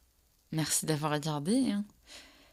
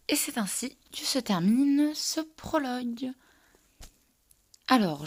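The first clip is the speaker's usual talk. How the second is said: ordinary delivery, nothing marked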